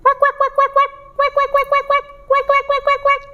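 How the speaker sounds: high, rapid bursts of quacks; duck quacking. Shown as a demonstration. Storyteller shows children the duck's voice, high quacking. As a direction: quack in quick runs like a little duck. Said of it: "Kalau bebek bagaimana suaranya".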